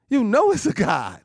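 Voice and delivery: "You know it's a God" called out high and loud; proclaimed. Shown as a demonstration. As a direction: fling the same line out to the church. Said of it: "repeats line, then proclaims".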